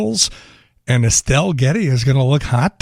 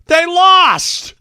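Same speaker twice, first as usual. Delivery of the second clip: high, loud mocking yell; Pope Leo yelling; 13 semitones higher, 5 dB louder than usual. Pope yelling back at a Cubs fan, loud and cheeky. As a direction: shout back with cheeky attitude. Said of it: "Pope Leo yells".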